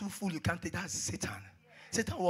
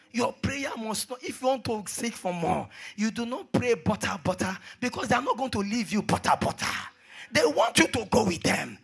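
raised exhortation building to long shouted words; fiery preaching; 5 semitones higher, 7 dB louder, slower than usual. Real, not acted. Preacher urging fervent prayer on the church, fiery preaching. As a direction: push harder, then hold the last words long and loud.

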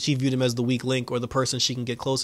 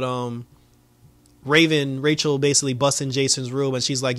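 ordinary talk, nothing marked

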